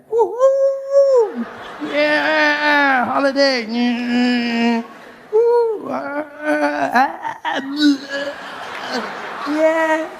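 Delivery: high squealing woo woo party cries; hammed-up holiday whooping; mock party whoops. Traveller acts out holiday partying.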